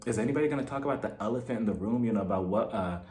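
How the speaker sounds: low, very soft, furtive imitation; hint secretly at gossip; under her breath. Tracy whispers to Melissa about Jennifer, under her breath. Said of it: "whispering underneath her breath".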